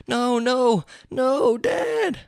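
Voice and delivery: put-on high boyish pleading; distraught son. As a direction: cry out as the horrified son. Son sees father shot.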